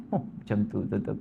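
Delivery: usual speaking voice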